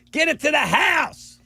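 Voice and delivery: high mock coach shout; college coach yelling. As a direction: bark it like an old college coach. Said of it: "he used to go".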